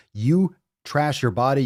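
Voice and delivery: ordinary talk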